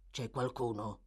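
low, wary thief's voice; Sikes asks. A narrator's reading. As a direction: drop it low and guarded. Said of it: "domandò Sikes".

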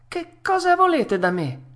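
high-pitched alarmed puppet; frightened cry. Acted. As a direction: cry out in fear, voice leaping up. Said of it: "tutto impaurito".

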